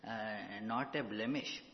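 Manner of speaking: ordinary talk, nothing marked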